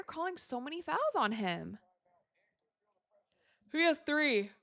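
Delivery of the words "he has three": talking through a yawn; words stretched by a yawn. Real, not acted. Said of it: "Sorry for yawning".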